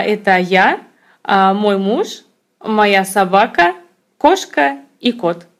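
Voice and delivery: usual speaking voice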